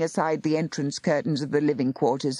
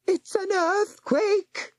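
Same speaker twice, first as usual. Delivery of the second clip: high, slow panicked howl; terrified howl; slower than usual. A narrator's reading. Housekeeper screaming at the warlock as the cliff shakes, panicked howl. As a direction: wail it out in terror. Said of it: "she howled at Nathaniel".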